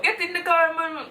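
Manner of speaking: high-pitched mimicry of a fake Jamaican accent; mock someone's high, put-on Jamaican voice; exaggerated Jamaican impersonation, pitched high; imitated Jamaican accent. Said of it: "I don't say that's how he said it".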